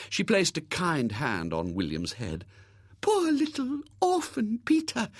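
high, cooing, pitying lady's voice; pitying spinster. Acted. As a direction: pity them like a sentimental old maid. Miss Rubina pitying.

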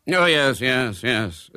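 brisk, dismissive repetition; testy hurry; impatient. A narrator's reading. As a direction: brush the worry aside. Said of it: "said Mister Brown impatiently".

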